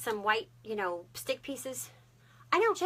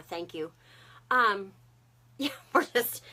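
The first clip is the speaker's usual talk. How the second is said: ordinary talk, nothing marked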